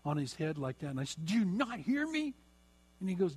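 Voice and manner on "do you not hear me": exasperated scold. Retold or imitated. Scolding son, hands on head.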